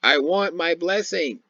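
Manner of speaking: slow, high-pitched mocking wail; mock bitter cry. Preacher mocks an Edomite demanding his blessing, mock bitter cry. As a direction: whine it drawn out, making fun of him. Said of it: "an exceedingly bitter cry".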